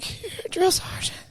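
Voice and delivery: tiny, high, slow squeak, almost voiceless; scared recruit. Retold or imitated. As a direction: answer in a small mock-terrified voice. Scared recruit answers sergeant.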